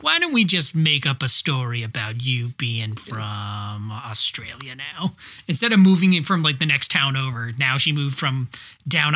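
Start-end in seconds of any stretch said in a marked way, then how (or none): 0.0-5.1 lower, slow, scheming voice; lightly voiced producer pitching a cheap fix; movie producers. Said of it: "They're like".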